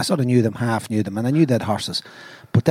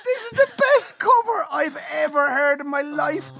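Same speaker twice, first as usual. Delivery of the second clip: awed excitement; 12 semitones higher than usual. Retold or imitated. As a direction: exclaim it as an overwhelmed fan. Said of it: "And we went".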